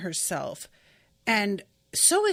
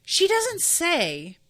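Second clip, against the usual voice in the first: doesn't pitched high and stretched slowly; stress on doesn't; 7 semitones higher, 4 dB louder, much slower than usual. Real, not acted. To her viewers, stressing doesn't with high slow emphasis. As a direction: lean hard on doesn't, drawn out. Stressing what the character omits.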